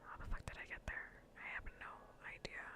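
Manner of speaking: normal talking voice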